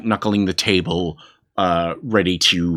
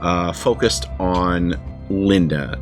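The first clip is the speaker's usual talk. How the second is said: story narration; 6 semitones lower than usual. Acted. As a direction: set the scene like a storyteller. GM opens the vampire story.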